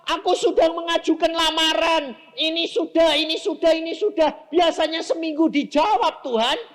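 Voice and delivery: high, loud, urgent preaching; shouted impatient plea. Acted. Preacher acting out a job applicant's prayer, shouted frustrated plea. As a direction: complain to God at full voice.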